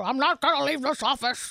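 put-on presidential impression, defiant refusal; Nixon. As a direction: play the stubborn politician in a caricature voice. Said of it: "Here's Nixon".

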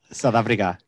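attempted South African vowels; South African accent. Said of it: "Is that how South Africans sound?".

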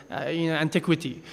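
usual speaking voice

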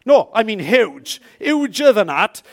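loud insistent correction; emphatic. Acted. Preacher insisting to the church, loud emphatic.

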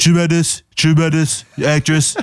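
a light Trump, bragging with pouted lips; lightly voiced Trump, boasting about an actress; Donald Trump voice. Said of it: "That's how you do a Donald Trump impression".

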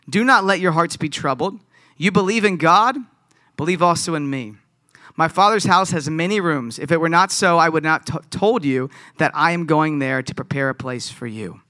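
loud, declaiming Bible reading; Scripture reading. Acted. Pastor reads John aloud to the church, declaiming. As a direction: proclaim the verses to the room.

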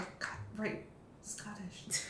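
put-on hushed, breathy aside remembering the accent; a soft muttered realisation, almost voiceless; mock sudden remembering. Imitating the actress catching her slipping accent, hushed. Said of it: "they'd be like".

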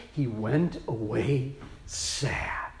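slow, soft hushed whisper. Acted. Telling the congregation the ruler went away sad, whispered slowly. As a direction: let it fall to a whisper.

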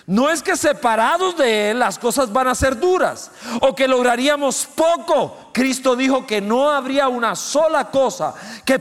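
high, loud preacher's cry; full-force sermon shout; fiery preaching. Acted. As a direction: drive it home from the pulpit.

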